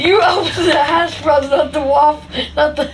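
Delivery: loud, high, slow through laughter; wailing with laughter; laughing complaint. Real, not acted. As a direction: protest while cracking up. Both cracking up over wrong bag.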